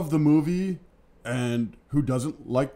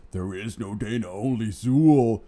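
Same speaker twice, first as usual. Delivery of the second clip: Dana as the gatekeeper; 3 semitones lower than usual. Retold or imitated. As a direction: imitate an eerie gatekeeper, stretching the ending. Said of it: "the gatekeeper on, on the roof".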